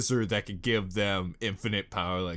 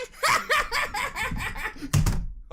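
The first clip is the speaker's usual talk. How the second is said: high laugh. Real, not acted. Laugh after mock farewell.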